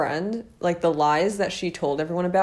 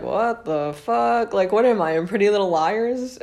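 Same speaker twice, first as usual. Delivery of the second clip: incredulous reaction; 4 semitones higher, 4 dB louder than usual. Retold or imitated. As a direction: react in exaggerated bewilderment. Reacts in disbelief to threatening text.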